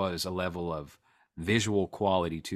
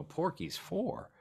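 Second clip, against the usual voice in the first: high, soft questioning rise; drawn-out, high-pitched mock puzzlement; puzzled question; 10 semitones higher, 7 dB quieter, slower than usual. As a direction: imitate people querying an unfamiliar title. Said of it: "people go".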